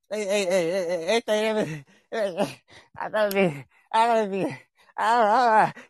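hammed-up stammering wannabe, high; a hint of a nervous poser. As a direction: imitate someone pretending to be hard. Mocking people who talk tough.

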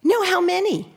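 high chirpy parrot voice; little birdie voice. Retold or imitated. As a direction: squawk it like a pet parrot. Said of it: "little birdie voice".

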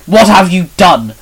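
loud, high burst of outrage; angry roar. A narrator's reading. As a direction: roar it as an accusation. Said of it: "Shinon roared".

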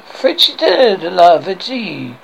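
slow, drawn-out mock French; French accent. French guard orders his comrade, slow mock French accent. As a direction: stretch out the comic French words. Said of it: "French guard".

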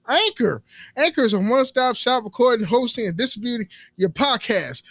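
loud, hammed-up podcast ad pitch; ad read. Podcaster pitching to would-be podcasters, loud ad read. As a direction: belt out the sponsor copy high and loud.

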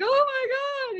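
high, loud imitation of wailing; mock wail. Wife crying out to her husband, mock wail. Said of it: "I'm wailing, I'm crying".

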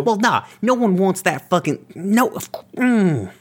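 high, heated outburst; sputtering with rage; angry. Acted. Player voices angry character.

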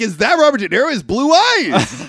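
mock confused viewer. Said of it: "You're like".

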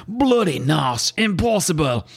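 drawn-out, mock Australian exclamation; Australian accent. Rock reacting to Time Trapper in an Australian accent. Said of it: "I decided he was Australian".

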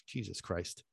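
a long, deflated sigh into a whispered curse; sighing under the breath; exasperated sigh. Retold or imitated. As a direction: breathe out the frustration, barely voiced. Gets home, special gone.

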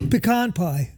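pecan said as puh-KAHN; puh-KAHN pronunciation. Shown as a demonstration. Co-host answering with the other way, pecan as puh-KAHN.

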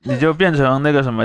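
usual speaking voice

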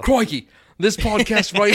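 put-on loud, high, over-the-top Aussie accent; Australian accent. Host joking with co-hosts, shouted caricature Australian accent. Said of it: "in my best Australian accent".

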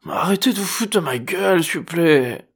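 high, pleading phone voice; weary young woman on the phone. A narrator's reading. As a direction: beg them to stop. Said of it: "répondit la voix au bout du fil". (voix aiguë lasse au téléphone)

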